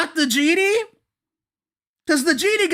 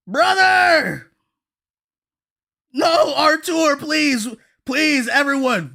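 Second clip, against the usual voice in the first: frantic calling. Real, not acted. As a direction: yell for a friend who has gone silent. Shouting for viewer in chat.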